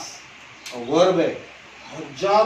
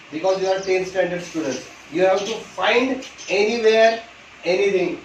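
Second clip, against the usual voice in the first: slow insistence; much slower than usual. Shown as a demonstration. Teacher pressing his students, slow insistent instruction. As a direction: lean on it so the class gets it. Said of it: "teacher insists to students".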